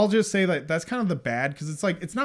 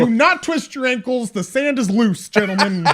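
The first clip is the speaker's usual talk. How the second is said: loud, high, hammed-up leader's warning; mock-heroic warning; 7 semitones higher, 9 dB louder than usual. Co-host parodying the hero cautioning gentlemen, pompously urgent. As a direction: call out grandly to the search party.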